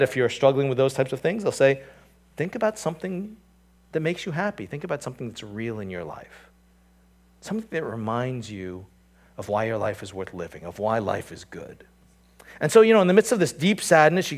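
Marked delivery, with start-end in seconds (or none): 2.4-11.8 softer, gentle advice; soft counselor voice. Pretend. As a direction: coax them like a therapist. Voicing a psychologist's advice.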